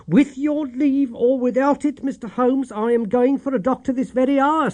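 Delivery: she finally stands up to him; defiant landlady. A narrator's reading. Landlady recalling her stand against Holmes, high and firm.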